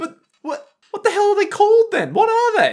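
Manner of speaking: very high, loud demand; shrieking in disbelief; high-pitched what-the-hell outrage; astonished. Real, not acted. Demands real name, astonished.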